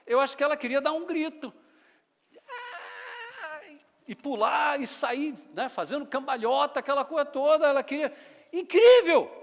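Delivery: high, loud, thrilled preaching; excited storytelling. Acted. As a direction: build the joy of the scene. Preacher excitedly imagines joy. (pregação empolgada e alta)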